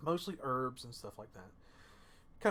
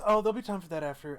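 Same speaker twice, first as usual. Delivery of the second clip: brisk chirpy doctor; 4 semitones higher, 9 dB louder, faster than usual. Acted. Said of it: "He says".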